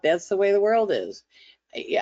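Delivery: usual speaking voice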